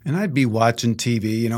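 ordinary talk

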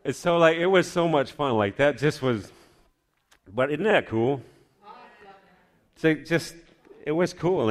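lively, excited retelling; excited gushing. Real, not acted. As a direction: gush about how fun it was. Gushing about a fun event.

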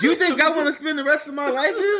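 high, laughing scoff. Retold or imitated. Scoffing at staying forever.

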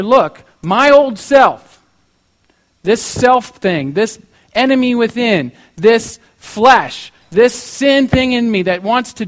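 slow, forceful preaching as Paul; loud, deliberate declaration against the old self; emphatic renunciation. Retold or imitated.

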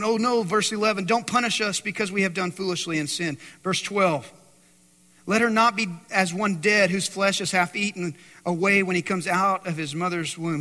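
loud scripture reading. Acted. Reading Aaron's plea, preaching.

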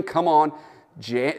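ordinary talk

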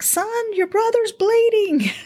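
high, exasperated scolding of her son; exasperated mom. Retold or imitated. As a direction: pitch it high with frustration, calling out to him. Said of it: "And I would get angry".

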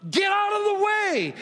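high, loud command thrown at the mountain; commanding shout. Acted. Preacher voicing God ordering the mountain aside, in a loud high shout. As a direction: bellow it like God moving a mountain.

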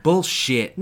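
loud, high scoffing snap; angry father. A narrator's reading. Father dismissing the child's monster. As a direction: spit it out in contempt.

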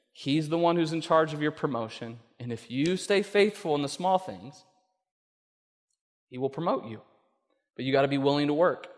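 ordinary talk

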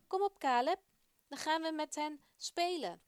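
put-on excited boy shouting to brother; eager call. Boy calling brother to play.

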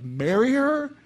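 sermon listener playing a shocked single; mock disbelief. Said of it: "You'd be like".

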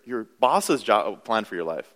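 normal talking voice

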